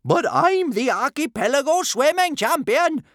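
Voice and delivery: very high, loud protest; indignant shout. A narrator's reading. Bertha disputing her defeat, indignant high shout. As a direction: shout your title in outrage. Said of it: "shouted Big Boobied Bertha".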